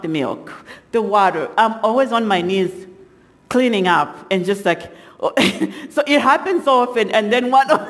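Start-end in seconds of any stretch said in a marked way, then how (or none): 5.2-7.9 talking through laughter, higher; real laughter. Real, not acted. Mother telling about spilled milk, really laughing. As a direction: try to go on while cracking up.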